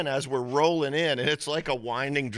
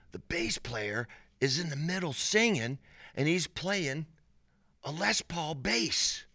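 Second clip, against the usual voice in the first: imitating excited buddy; 6 semitones higher than usual. Buddy leaning over to report on the band, imitated excitement. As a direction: play the buddy passing on an amazing sight.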